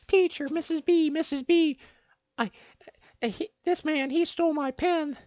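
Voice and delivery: high loud tattling voice; tattling girl. Retold or imitated. Girl tattles to teacher Missus B, high and loud. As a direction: call the teacher over in a squeaky whine.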